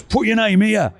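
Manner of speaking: a retold fan eagerly asking for an autograph. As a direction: push the pen at him keenly. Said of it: "He goes".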